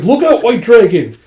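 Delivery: high, loud alarm; acted warning shout. Bystander warns the dragon of a sword, loud alarm. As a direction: shout to warn of an attack.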